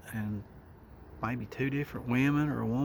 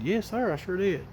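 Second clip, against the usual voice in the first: much higher, eager to be believed; 7 semitones higher than usual. Retold or imitated. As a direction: shoot up high, nodding eagerly. Eager answer to the guard.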